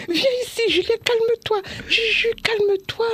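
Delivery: put-on high-pitched mother calming a child; acted anxious coaxing; re-enacted urgent pleading. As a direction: plead with a panicking child to settle, voice way up. Mother restraining her panicking child.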